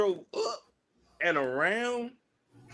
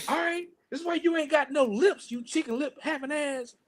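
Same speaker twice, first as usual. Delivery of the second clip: high-pitched mock scolding woman; hammed-up street insult, high voice; imitating angry woman; 9 semitones higher than usual. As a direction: squawk it out like the woman in the station. Woman yelling at man in Penn Station.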